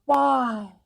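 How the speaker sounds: high, loud surprised gasp-word; be caught off guard; high, loud surprise; exclaiming. Acted. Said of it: "she exclaimed".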